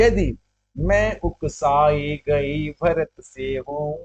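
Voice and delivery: slow, stretched-out line of poetry; verse recitation. Acted. Teacher reciting Kaikeyi's oath to Ram, slow verse recitation.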